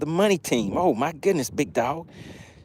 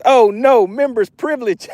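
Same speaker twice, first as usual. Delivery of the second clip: mock dismay; 6 semitones higher, 3 dB louder than usual. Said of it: "Big Dog says".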